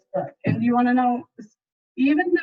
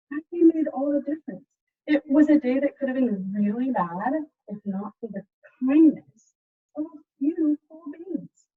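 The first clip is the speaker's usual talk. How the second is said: put-on lively picture-book narration for kids; story reading; 4 semitones higher than usual. A narrator's reading. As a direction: read it like a storyteller to a class. Teacher reads picture book aloud.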